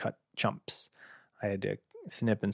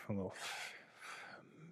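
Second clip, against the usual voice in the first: swallowed swear; 18 dB quieter, slower than usual. Acted. Teasing baby, cuts off swear.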